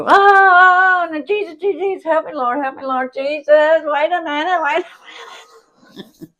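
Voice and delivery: mock panic, calling on the Lord; panicked wailing. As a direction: cry out to Jesus in hammed-up terror. Scared sister panicking at the needle.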